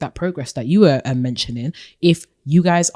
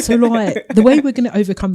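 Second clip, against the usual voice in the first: louder, quick mock lad accent; British lad accent; 6 semitones higher, 5 dB louder than usual. Shown as a demonstration. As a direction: play a chatty British lad. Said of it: "Maybe the lad".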